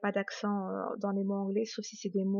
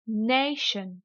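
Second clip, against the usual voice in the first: "nation" in English, NAY drawn out; teacher's over-stressed "nation", falling after NAY; exaggerated English stress. Shown as a demonstration. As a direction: lean hard on NAY in "nation", light "shun". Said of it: "je vais délibérément exagérer".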